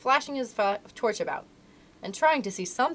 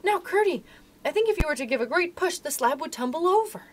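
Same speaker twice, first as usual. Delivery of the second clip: high, eager and joyful, only lightly hushed; joyous stage whisper; 7 semitones higher than usual. A narrator's reading. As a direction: voice a girl's joyful secret, pitched up. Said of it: "said in a joyous whisper".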